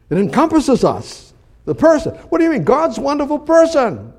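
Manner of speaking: high, loud, rising with fervor; fervent exclamation. Acted. Preacher marvelling at God's person, high and loud. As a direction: proclaim it with mounting excitement.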